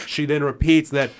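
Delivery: normal talking voice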